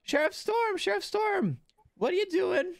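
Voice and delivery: put-on high-pitched eager kid; very high child calling to the sheriff; child's voice. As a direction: call out in a little kid's high squeal. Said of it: "you hear a child's voice".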